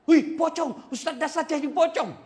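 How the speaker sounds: high, loud panicked cry with a laugh; frightened villagers. Retold or imitated. Villagers running from the pocong, loud panicked shriek. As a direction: shriek at the sight of a ghost. Villagers scream seeing ghost.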